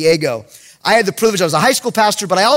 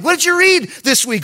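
eager question; 8 semitones higher than usual. Retold or imitated. Pastor questioning a kid, acted.

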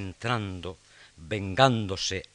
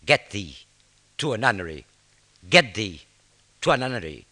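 booming theatrical declaiming, louder; hammy stage actor; 10 dB louder, slower than usual. Acted. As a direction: project to the back row like an old tragedian. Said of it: "Se oye por todo el teatro".

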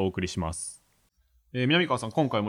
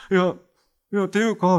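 trembling voice; 8 semitones higher, 6 dB louder than usual. Retold or imitated. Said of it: "声震えてて".

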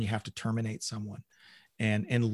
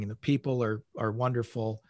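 ordinary talk

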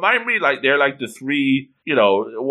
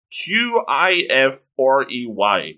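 slow, letter by letter; spelling it out; slower than usual. Shown as a demonstration. Reader spelling Q I F R E Y for listeners, letter by letter. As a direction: spell Q I F R E Y with each letter separate.

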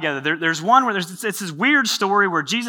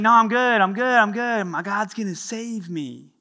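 stubborn refusal; 5 semitones higher, slower than usual. Acted. Man refusing again, insistent.

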